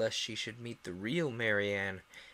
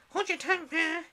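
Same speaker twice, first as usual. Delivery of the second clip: much higher, louder snap; snappish; 7 dB louder than usual. A narrator's reading. Young crab snapping at her mother, loud and high. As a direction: bite back at the parent. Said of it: "a little snappishly".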